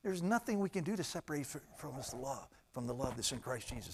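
ordinary delivery

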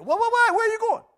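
high, loud pushy drinking buddy; hammed-up friend who won't let you leave; pushy bar friend. Bar friend loudly stopping you from leaving, high and pushy. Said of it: "had a friend say".

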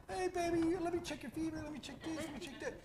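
high, sweet doting wife voice; imitating wife. Wife fussing over her feverish husband, sweetly cooing. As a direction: coo like a wife babying her sick husband.

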